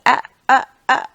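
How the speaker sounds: short, loud "ah" bursts, imitating someone cut off; stammered attempts to speak. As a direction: try to get a word out and fail. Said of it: "trying to get a word out".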